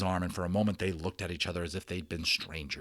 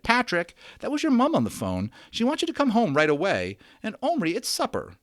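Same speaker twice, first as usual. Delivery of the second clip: much higher, louder mother calling in; 12 semitones higher, 6 dB louder than usual. A narrator's reading. Omri's mother tells the boys Patrick must go home, calling. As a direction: call the boys like a busy mum.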